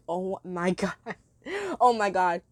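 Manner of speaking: ordinary talk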